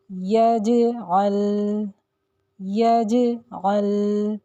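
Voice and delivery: यजअल very slow, the ज bounced; qalqala on jim. Shown as a demonstration. Teacher reciting the jim example twice. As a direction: drawl यजअल and shake the ज. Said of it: "जीम को हमने यहाँ पर हिलाकर पढ़ा".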